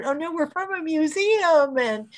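high-pitched pleading explanation; retold innocent protest. Museum staff explaining themselves to cops searching the truck, innocently protesting.

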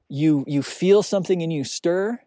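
ordinary delivery

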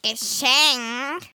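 suspicious growl. A narrator's reading. Witch answering the offer of a present, suspicious growl. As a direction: growl it with distrust. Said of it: "knurrte Arabella misstrauisch".

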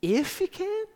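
high squeaky disbelieving question; squeaky incredulous. Acted. Jesus repeating the father's words, squeaky and high. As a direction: voice jumps way up in disbelief. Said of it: "his voice went up and got squeaky".

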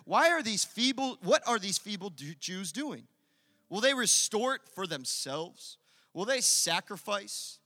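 loud, high, scornful jeer at the builders; angry jeering. Acted. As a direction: sneer it like a furious enemy. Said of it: "can you just hear that tone".

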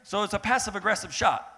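ordinary talk, nothing marked

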